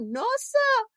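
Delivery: high-pitched, incredulous "no, sir"; mock-astonished Jamaican exclamation; friend's disbelief. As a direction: imitate a friend refusing to believe it. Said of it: "they were like".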